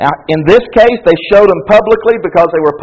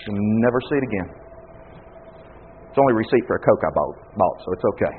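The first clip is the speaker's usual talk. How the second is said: quick mocking aside; 13 semitones lower, 13 dB quieter, faster than usual. Mocking the trivial confessed sin.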